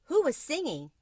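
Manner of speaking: a mother calling out, who sang; Italian mama asking. A narrator's reading. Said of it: "said Mamma".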